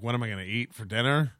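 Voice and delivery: ordinary delivery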